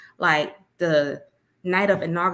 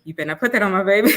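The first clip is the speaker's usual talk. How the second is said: quick, louder playful warning; mock stern warning; 5 dB louder, much faster than usual. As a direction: snap it fast like a protective mom. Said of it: "I'm like".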